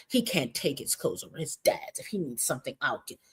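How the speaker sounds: sulky mutter under the breath; mock bitter. Commentator imitating Chrissy acting bitter, muttered softly. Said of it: "So here's Chrissy acting bitter".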